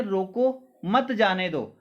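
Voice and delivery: slow रोको, then मत जाने दो as one falling group; pause after रोको. Shown as a demonstration. Child telling parents about guests, pause after रोको. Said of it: "बनाम चिन्ह आपने तो यहाँ लगाया".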